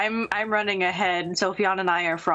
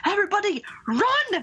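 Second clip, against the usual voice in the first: high-pitched acted alarm call; rally the crowd to flee; 13 semitones higher than usual. Player's character to the crowd, high urgent call.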